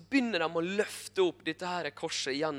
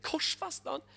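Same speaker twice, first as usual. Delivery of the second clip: incredulous Pilate; 11 semitones higher, 4 dB quieter than usual. Retold or imitated. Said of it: "Pilatus kan ikke tro sine egne".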